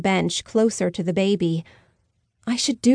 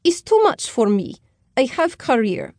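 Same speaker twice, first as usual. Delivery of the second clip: put-on Slavic accent; 4 semitones higher than usual. Slavic woman leaving the baby.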